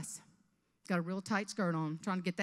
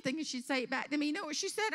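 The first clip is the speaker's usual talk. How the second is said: ordinary talk, nothing marked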